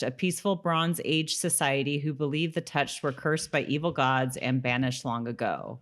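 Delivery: ordinary talk, nothing marked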